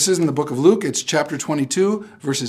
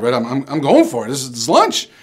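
normal talking voice

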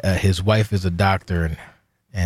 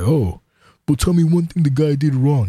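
higher-pitched, whining mimicry; mocking a friend; 7 semitones higher, 3 dB louder than usual. Speaker mimicking best friend defending the guy, mockingly. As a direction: imitate a defensive friend's protest.

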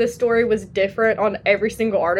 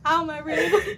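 high, laughing and crying; wheezing with laughter; laughing in pain; 7 semitones higher, 4 dB quieter, slower than usual. Real, not acted. Laughing so hard it hurts.

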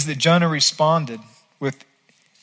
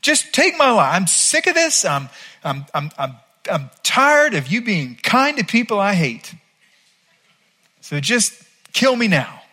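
much higher, strained and exasperated; fed-up rant; 6 semitones higher than usual. Retold or imitated. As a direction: beg to die in mock fury. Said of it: "That's what he says".

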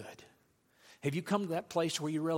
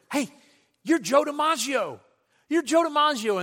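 high, loud thrilled recognition; excited fan; 10 semitones higher, 9 dB louder than usual. Retold or imitated. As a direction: call out like you just spotted a star. Fan spotting the star.

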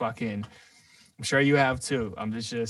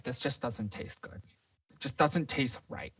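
slow, imitated grumble from a customer; retold fussy griping; imitated bitching; 5 dB quieter than usual. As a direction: mildly mimic a customer's drawn-out complaint.

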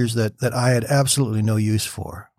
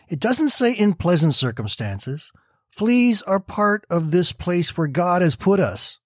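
higher, slow patient correction; a lightly voiced patient Betsy; firm gentle insistence; 6 semitones higher than usual. A narrator's reading. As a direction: make her see the fleas belong. Betsy insists fleas count too.

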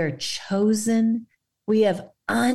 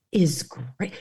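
whispered praise. Retold or imitated. Jesus praising faith.

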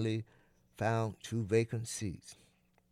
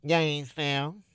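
nasal announcer over a speaker; pinched-nose voice; 2 semitones higher, 4 dB louder than usual. Acted. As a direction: Gainesville through a pinched nose. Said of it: "like a clothespin pinched his nose".

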